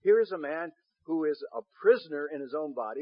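usual speaking voice

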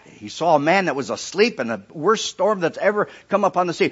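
ordinary delivery

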